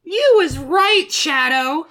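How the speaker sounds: very high, slow cartoon squeak; Tails, timid. Reader voices Tails siding with Sonic, high, slow and timid. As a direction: pipe up like a little cartoon sidekick.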